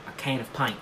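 "paint" said as "pint" in Australian; softly demonstrated Australian "can of pint"; Australian accent. Shown as a demonstration. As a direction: practise Australian vowels on "paint". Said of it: "get into the Australian accent".

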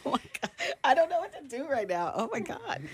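fighting back real tears at a surprise; choked up. Real, not acted. Award winner, overwhelmed on air, tearfully stunned. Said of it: "I'm not even a crier".

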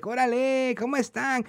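hammed-up Mexican accent, pitched high; mock Mexican greeting. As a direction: play a tourist putting on Mexican Spanish. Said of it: "Imagine if I went to, like, Mexico".

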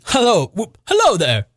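high, giddy hallooing; merry whoops in character; joyful whooping. A narrator's reading. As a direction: halloo with childish glee. Scrooge whooping with joy.

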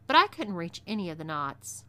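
ordinary delivery, nothing marked

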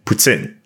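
"Poutine" like Putin with an S between T and I; quick ts in "Poutine", short final vowel; S run through. Shown as a demonstration. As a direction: say "Poutine" as "put-SIN". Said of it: "run through the S when you say his name".

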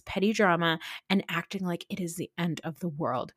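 ordinary talk

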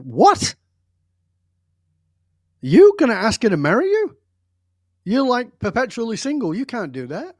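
loud, high-pitched mock disbelief; incredulous friend. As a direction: imitate a stunned friend, loud outburst easing off by the end. Friend shocked at marriage plan.

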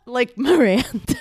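Miranda-stem. Retold or imitated. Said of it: "I always do Miranda".

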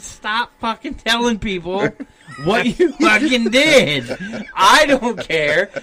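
retold exasperated order to shut up; exasperated scolding. Retold scolding of a loose-lipped coworker.

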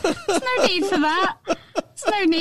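retold amused "don't bother", drawn out; amused protest. Telling how she answered her considerate boyfriend, amused protest.

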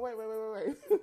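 laughing. Real, not acted. Laughing through tears on camera.

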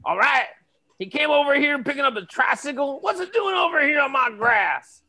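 angry neighbor. Acted.

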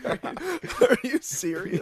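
slow, high and laughing; laughing disbelief. Real, not acted. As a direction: ask it while cracking up. Incredulous while laughing.

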